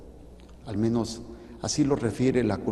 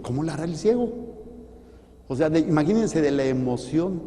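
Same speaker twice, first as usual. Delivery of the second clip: usual speaking voice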